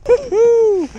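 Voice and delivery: real joyful whoop; triumphant whoop. Real, not acted. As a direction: let out a victory whoop. Celebrating a catch.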